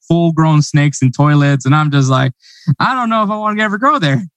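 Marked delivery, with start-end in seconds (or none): 2.8-4.2 high-pitched, playful reluctance; half-joking wariness, pitched up; mock apprehension. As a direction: joke nervously in a raised pitch. Joking quote of own scared reaction.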